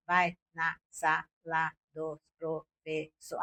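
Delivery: slow, halting read, one syllable at a time. Retold or imitated. Pupil spelling out the teacher's note, syllable by syllable. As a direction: sound out each syllable like a struggling reader. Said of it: "sílaba por sílaba".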